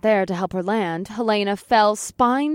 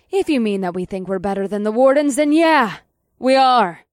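defiant shrug; 2 semitones higher, 5 dB louder than usual. A narrator's reading. Hero answers the villain's accusation, with a defiant shrug. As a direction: toss it back, rising on the yeah. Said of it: "I said with a shrug".

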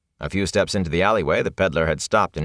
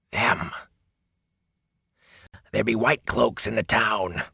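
hoarse whisper; 4 semitones higher than usual. A narrator's reading. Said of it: "his voice dropped into a hoarse whisper".

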